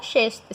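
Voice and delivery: "sexto" said with SH sounds, like SHESH-to; "sexto" hushed into SH; regional SH pronunciation. Shown as a demonstration. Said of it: "algumas regiões do país, eles falam".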